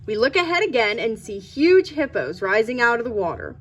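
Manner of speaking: ordinary delivery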